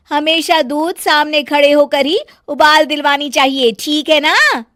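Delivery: high, loud lecturing daughter-in-law; bossy bahu. Acted. Bahu lecturing Sonam about boiling milk, loud bossy voice. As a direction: scold her like a know-all bahu.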